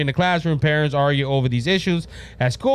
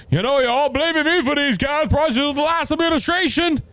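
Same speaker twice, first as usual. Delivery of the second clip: high, put-on mocking president voice; a mock, higher old president voice; bad Biden impression; 9 semitones higher than usual. Podcaster mocking the president on TV, in a high put-on voice. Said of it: "I know that's a bad Biden impression".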